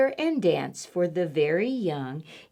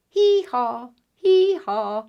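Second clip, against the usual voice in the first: donkey bray; 3 semitones higher, 5 dB louder than usual. Acted. Repeat, donkey sound.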